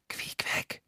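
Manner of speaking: whispered through a keyhole. A narrator's reading. Narrator whispering through the keyhole, whispered. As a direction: breathe the name. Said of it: "flüsterte ich durch das Schlüsselloch".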